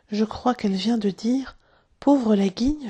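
plain reading voice

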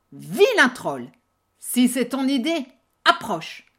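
loud defiant challenge. Acted. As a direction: dare the troll to come. Big goat defies the troll.

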